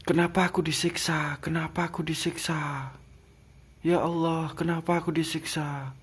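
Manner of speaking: desperate pleading. Retold or imitated. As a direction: beg to know why you are suffering. Pleading while being tortured. (memohon dengan putus asa, nada tinggi)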